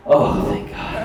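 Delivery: slow, breath-filled half whisper; mock relief. Office staff relieved about the accusation, slow whispered mock relief. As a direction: sigh it out slowly, acted relief.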